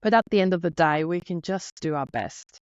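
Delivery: normal talking voice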